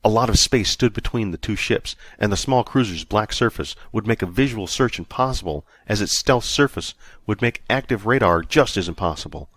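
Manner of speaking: ordinary delivery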